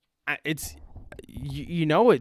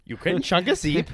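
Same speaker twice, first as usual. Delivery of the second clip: louder, higher fake German; mock German accent; 5 semitones higher, 5 dB louder than usual. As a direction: repeat the slip as a put-on German line. Slip becomes mock German bit.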